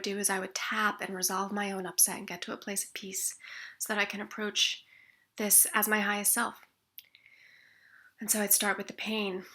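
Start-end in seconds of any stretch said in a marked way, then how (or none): none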